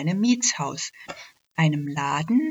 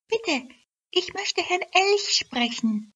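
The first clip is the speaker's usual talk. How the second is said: much higher, tiny polite elf voice; high little elf voice; 14 semitones higher than usual. Acted. Elf asks the bank clerk for Mr Elk, tiny high polite voice. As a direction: ask politely like a tiny fairy.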